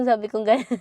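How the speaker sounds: usual speaking voice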